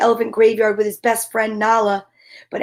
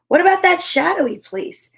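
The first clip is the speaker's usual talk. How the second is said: lightly voiced curious cub; 6 semitones higher than usual.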